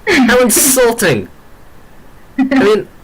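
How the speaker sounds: high, slow, played-up indignation; mock offended. Host protesting at a teasing guest, mock offended. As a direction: draw it out high as if insulted.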